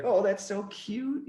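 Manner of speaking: high, drawn-out mock cooing; imitating someone charmed by a flirt; gushing. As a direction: coo it slowly, way up high. Imagined listener gushing over Eric's flirting.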